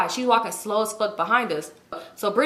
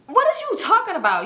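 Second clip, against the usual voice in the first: high-pitched, indignant disbelief; exasperated, pitch leaping up; incredulous outburst; 11 semitones higher, 4 dB louder than usual. Acted. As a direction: throw it back at him in disbelief. Acted outrage at his apology.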